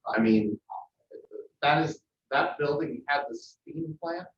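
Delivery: normal talking voice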